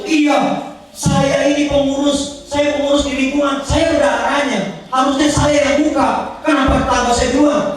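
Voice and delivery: high, indignant protest; aggrieved complaint. Retold or imitated. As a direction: complain with hurt pride, voice way up. Customer complaining about neighbour. (ibu pengurus mengeluh dengan nada tinggi dan tersinggung)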